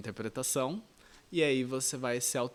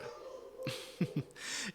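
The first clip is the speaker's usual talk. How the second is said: laugh; 7 semitones higher, 7 dB quieter than usual. Real, not acted. Laughs at own British attempt.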